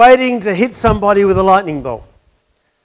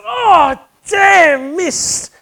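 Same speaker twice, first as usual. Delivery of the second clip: much higher, louder cry of annoyance; exasperated curse; 10 semitones higher, 3 dB louder, much slower than usual. Retold or imitated. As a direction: yelp it out as the ball pops out. Golfer misses third putt.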